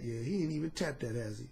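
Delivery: low hushed purr; soft satisfied murmur; quiet, low and pleased; smooth serpent. Retold or imitated. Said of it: "that serpent was smooth".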